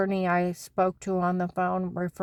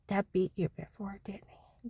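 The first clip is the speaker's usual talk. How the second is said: soft fading whisper; 7 dB quieter than usual. Retold or imitated. Wife asking Carl about Deb's beatings, whispering. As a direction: ask it gently under the breath.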